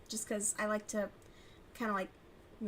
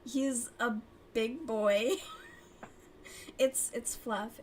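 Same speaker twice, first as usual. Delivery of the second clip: usual speaking voice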